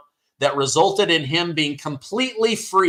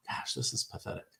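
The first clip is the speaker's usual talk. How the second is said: whispered, scornful aside under the breath; disgusted whisper; 7 semitones lower, 16 dB quieter, faster than usual. Real, not acted. Scrolling Twitter, disgusted aside.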